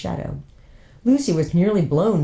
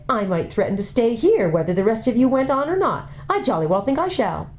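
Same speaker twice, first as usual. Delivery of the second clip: put-on higher, peevish older girl; peevish sister; 7 semitones higher than usual. Older sister threatening to stay behind, peevish. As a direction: needle her sister.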